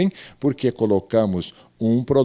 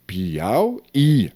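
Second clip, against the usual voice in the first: "Piauí" drawn out as Piau, Í; separated hiatus. Shown as a demonstration. Teacher shows where the accent goes, separated hiatus. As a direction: set the final stressed í of "Piauí" apart. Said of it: "eu separei só o hiato".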